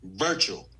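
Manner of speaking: "virtual" set apart after a pause and pitched low as the key word; stress on virtual. Shown as a demonstration. Zoom host underlining the word for new recruits, emphatic stress. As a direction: lean on "virtual" on its own, low and deliberate. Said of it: "Emphasis on the word".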